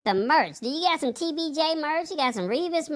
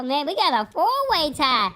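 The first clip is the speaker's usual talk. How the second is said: usual speaking voice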